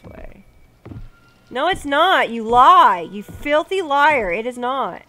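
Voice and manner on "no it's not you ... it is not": loud, high protest; angry argument. Real, not acted. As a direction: yell back at the liar. Arguing with game character.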